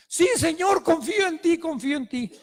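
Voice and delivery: grab at rescue with joyful desperation; relieved pleading climber. Acted. Climber assures God.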